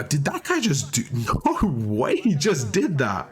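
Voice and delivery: laughing, slowed disbelief; incredulous. Real, not acted. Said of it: "I'm in disbelief right now".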